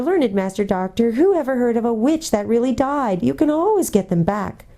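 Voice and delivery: put-on high, wheedling old hag; shrill whining. A narrator's reading. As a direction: whine it high, fawning on each listener. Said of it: "the shrill whining voice began".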